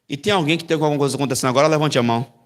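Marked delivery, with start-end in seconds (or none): none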